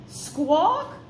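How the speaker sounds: sharp high squawk; bird squawk. A narrator's reading. Narrator voicing a robin's sharp squawk.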